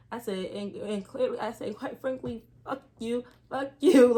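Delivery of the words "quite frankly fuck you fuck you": second fuck you shouted, higher; cussing out. Retold or imitated. Assistant cussing out her boss, shouting the last fuck you. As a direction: blurt it out, then yell. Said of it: "that's when I started cussing".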